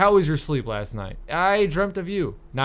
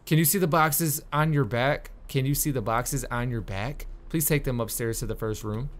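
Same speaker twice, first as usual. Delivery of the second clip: ordinary talk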